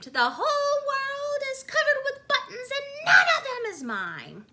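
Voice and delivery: very high, loud angry scream; furious scream. A narrator's reading. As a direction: scream it in a tantrum. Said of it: "jumped up and down and screamed".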